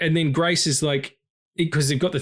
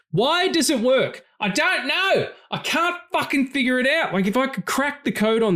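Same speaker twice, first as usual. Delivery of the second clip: exasperated wonder; 13 semitones higher than usual. Real, not acted. Host marvelling to cohost why the film moves him, high baffled wonder. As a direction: throw up your hands, baffled by how it works. Marvelling at film's emotional power.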